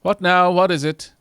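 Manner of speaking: loud, sharp startled demand; startled shout. Acted. NPC startled by the visitor, loud shout.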